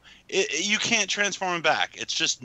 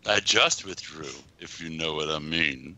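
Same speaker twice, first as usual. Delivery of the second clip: mock-suggestive wink, dropped much lower and softer; suggestive innuendo voice; 12 semitones lower, 4 dB quieter than usual. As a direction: slide it out low like a sly innuendo. Mocking the innuendo in a quote.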